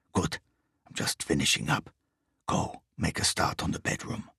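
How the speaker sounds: low, soft and breathy foreign thief's whisper; a heavy foreign voice kept down to a hush; low whispered foreigner. A narrator's reading. Foreign thief whispers orders to his partner, low and hushed.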